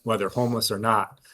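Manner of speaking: ordinary talk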